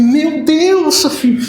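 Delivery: high shocked cry, retold. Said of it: "Ele falou". (espanto imitado, agudo)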